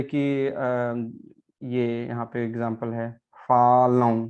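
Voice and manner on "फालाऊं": फालाऊं ends in a nasal आऊं; nasal am ending. Shown as a demonstration. Teacher contrasting falam with the wrong फालाम. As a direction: say फालाऊं with the ending through the nose. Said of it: "फालाम नहीं".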